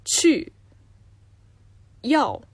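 去 and 要 both said with a sharp falling fourth tone. Shown as a demonstration. Host demonstrating fourth tones, sharp falling. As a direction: drop 去 and 要 hard from high. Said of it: "同じ第四声の".

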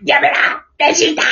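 high choked cry of Goku; strangled voice. Retold or imitated. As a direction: cry out while being strangled. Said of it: "首絞められてる時の声".

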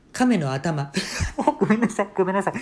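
laughing, then high, loud sorries; giggling apology. Retold or imitated. Mother cracking up at her own joke, laughing.

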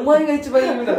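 laughing retort, through giggles; a laughing jab back. Retold or imitated. Retorting again.

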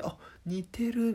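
high, slow mock delight; delighted clerk. Imagined clerk praising likeness.

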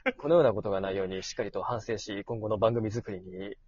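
low, soft formal apology voice; good voice. Pretend. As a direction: put on a deep, solemn apology voice. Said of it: "いい声を作って".

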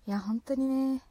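usual speaking voice

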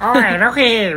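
high, thin old man imitation. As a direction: croak it like a tavern grandpa. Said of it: "おじいちゃんの声しててさ".

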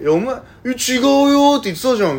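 high, loud imitation of an incredulous brother; mock indignant disbelief. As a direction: protest in disbelief, pitch shooting up. 兄が後で真相を知り抗議. (驚いて抗議する兄の大げさな再現)

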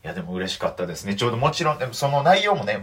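ordinary delivery

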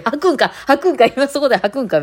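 high, alarmed blurting; mock panic. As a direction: act out startled alarm at the cat. Acting alarm at cat about to vomit.

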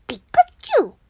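squeaky high Pikachu cry, loud; Pikachu impression. Streamer doing a bad Pikachu for viewers, high and loud. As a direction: chirp it out like the little yellow mouse. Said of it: "このクソ似てないピカチュウ".

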